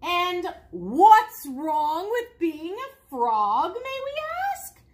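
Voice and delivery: loud, slow, drawn-out indignation; offended exclamation. A narrator's reading. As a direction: exclaim it as the affronted frogs, stretching the words. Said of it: "exclaimed the frogs".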